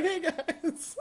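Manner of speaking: soft, very high little voice; faint singsong hello through laughter; mock friendly greeting. Voicing the man waving in the background, mock greeting.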